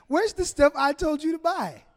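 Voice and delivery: puzzled wife digging through bags; wife searching bags. Retold or imitated. Wife checking the grocery bags, mock puzzled. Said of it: "And she says".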